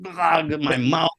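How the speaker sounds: usual speaking voice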